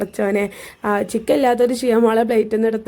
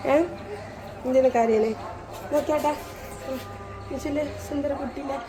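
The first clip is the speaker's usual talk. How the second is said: soft coaxing; 3 semitones higher, 8 dB quieter than usual. Real, not acted. Mother cooing to crying son.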